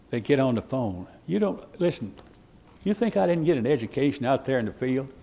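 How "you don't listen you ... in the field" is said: ordinary talk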